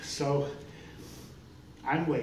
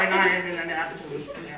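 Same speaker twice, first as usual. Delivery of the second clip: ordinary delivery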